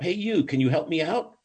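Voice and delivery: mild, high-pitched call for help; acted shout up to a passer-by. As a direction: call up from down in a hole, without full volume. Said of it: "the guy shouts up".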